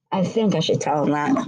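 ordinary delivery

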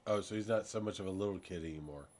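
normal talking voice

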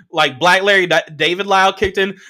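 ordinary talk

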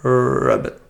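croaky frog ribbit. Pretend. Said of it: "can you do a frog ribbit".

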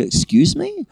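high-pitched indignant retort, acted out; mock indignation. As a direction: act out being affronted, pitch shooting up. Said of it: "I was actually really angry with her".

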